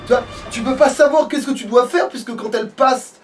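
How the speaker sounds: ordinary talk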